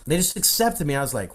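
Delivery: ordinary delivery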